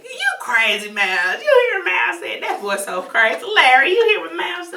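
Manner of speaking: high laughing mom voice, loud; doting mom laughing at her son; imitating mom. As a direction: giggle it out like a doting mother. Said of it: "He thinks, like".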